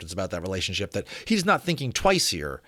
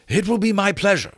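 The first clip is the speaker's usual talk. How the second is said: higher, brighter polite acceptance; lightly voiced Sir Edward; 11 semitones higher, 5 dB louder than usual. Sir Edward accepting Lawrence's dinner invitation, higher and louder. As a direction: accept the invitation graciously, up high.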